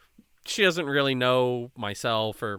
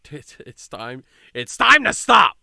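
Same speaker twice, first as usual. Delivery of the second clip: firm stop; 10 dB louder than usual. Retold or imitated. Telling the guy at the party to stop, a loud firm call. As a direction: raise the voice to cut him off.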